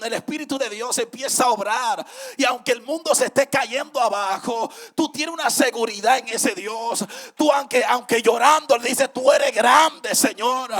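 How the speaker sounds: loud, rising sermon cry with a sung lilt; full-force preaching. Acted. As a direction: preach it out over the music to the congregation.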